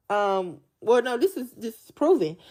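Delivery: ordinary delivery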